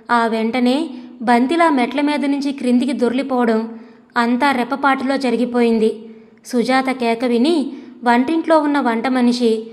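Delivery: ordinary delivery, nothing marked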